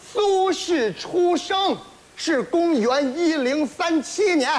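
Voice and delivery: slow, careful enunciation; slow and clear. Shown as a demonstration. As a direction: say each word slowly and clearly. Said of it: "没听明白吗".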